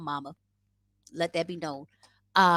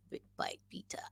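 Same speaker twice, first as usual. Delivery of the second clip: whispered tries; 17 dB quieter than usual. Shown as a demonstration. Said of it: "How do you say".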